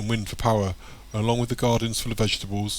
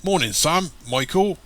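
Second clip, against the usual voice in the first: high-rising hearty greeting; hearty call; 7 semitones higher, 3 dB louder, slower than usual. A narrator's reading. Market trader greets Sam, calling out heartily. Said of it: "hearty man calls out to Sam".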